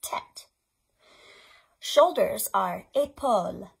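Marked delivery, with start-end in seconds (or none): 0.0-0.5 soft unvoiced tête; whispered tête. Shown as a demonstration. Librarian modelling the French word tête, whispered. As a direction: breathe tête for the kids to copy. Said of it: "Can you say that?".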